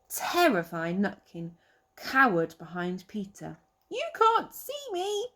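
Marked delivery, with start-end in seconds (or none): 3.9-5.3 high squeaky little squirrel. Acted. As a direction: play the cheeky tiny squirrel. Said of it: "he squealed".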